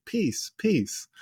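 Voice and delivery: mocking. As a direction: parrot the word back with scorn. Mocking Hitler's peace overture.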